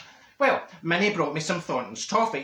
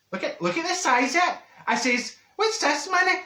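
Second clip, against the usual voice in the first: indignant surprise; 10 semitones higher than usual. Acted. Marjorie to her friend Minnie, high indignant disbelief.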